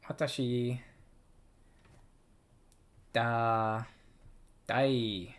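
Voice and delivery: stretched learner reading; slow drawn-out. Shown as a demonstration. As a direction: draw out "daisuki" long and sung. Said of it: "drawn-out practice".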